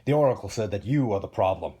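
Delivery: loud, accusing snarl; angry king's growled accusation. Acted. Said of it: "he snarled".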